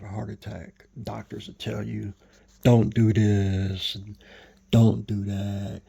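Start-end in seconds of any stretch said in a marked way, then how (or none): 2.6-5.8 slow, loud, stern doctor's orders; stern warning. Retold or imitated. Doctors warning a heart patient, loud and slow. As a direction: lay down the law like a doctor.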